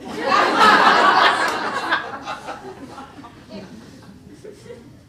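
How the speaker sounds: loud rising laughter. Real, not acted. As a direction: burst out laughing.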